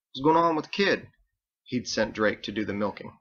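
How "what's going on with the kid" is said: higher, concerned other voice; worried sailor. A narrator's reading. Worried sailor asking Andelin about the kid, higher voice. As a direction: ask after the kid, worried.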